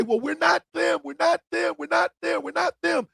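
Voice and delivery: high, whiny repeated "we're not them"; mocking the Democrats. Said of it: "When you hear the Democrats say".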